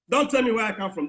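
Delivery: usual speaking voice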